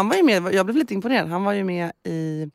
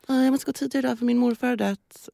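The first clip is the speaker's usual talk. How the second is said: higher-pitched, lightly mocked pupil excuse; imitated work-experience pupil; 5 semitones higher than usual. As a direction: quote a pupil asking to leave early. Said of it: "en praoelev som en dag var så här". (ljus, lätt härmad praoelevröst)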